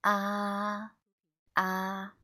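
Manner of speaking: "Ah" held level and slightly high; a long, unwavering "Ah" like a held note; first tone. Shown as a demonstration. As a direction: sustain "Ah" on one steady, horizontal pitch. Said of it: "the first tone is horizontal".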